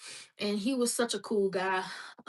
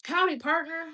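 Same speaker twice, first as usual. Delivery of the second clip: mock cowboy greeting; 7 semitones higher, 4 dB louder than usual. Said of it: "repeat greeting".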